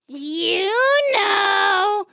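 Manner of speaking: high, drawn-out parrot taunt. Acted. Parrot needling the lady after the warning, high slow squawk. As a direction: stretch both words, climbing into a squawk. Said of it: "Parrot says".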